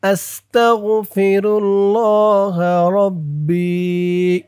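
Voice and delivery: drawn-out chanted recitation, long vowels held; teacher reciting for students to repeat; slow recitation. Shown as a demonstration. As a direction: recite slowly with full elongation. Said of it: "আমি যেখানে যেমন টান দিব".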